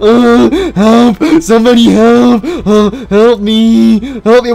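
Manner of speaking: high panicked cries for help; fluttering cries for help. Real, not acted. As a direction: scream for help in terror. Chased by clones, crying for help.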